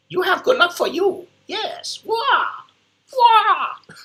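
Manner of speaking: squeaky mock Bruce Lee wail; Bruce Lee impression. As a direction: shriek it like a kung fu hero, over the top. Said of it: "I'm trying to do my best or my worst Bruce Lee impression".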